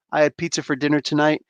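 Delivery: ordinary delivery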